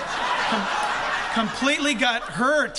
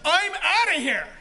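excited exit line; 5 semitones higher, 3 dB louder than usual. Pretend. Acts out Lazarus leaving the tomb.

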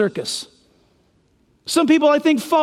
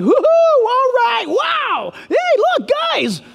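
giddy Herod; 12 semitones higher, slower than usual. Retold or imitated. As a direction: whoop like an overexcited king at a show. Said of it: "He's like".

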